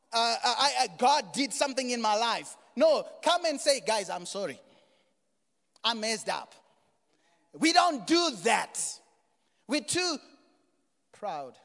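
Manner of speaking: forceful preacher's scolding; full-force preaching. Real, not acted. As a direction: call out the congregation at high volume.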